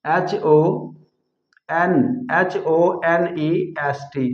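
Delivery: slow letter-by-letter spelling. Shown as a demonstration. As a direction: spell each letter slowly and clearly. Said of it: "ईमानदार के लिए honest". (धीरे-धीरे अक्षर बोलकर spelling)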